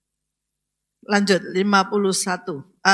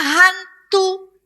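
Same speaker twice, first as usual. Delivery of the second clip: retold yell; 7 semitones higher, 4 dB louder than usual. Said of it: "Dia malah teriaknya".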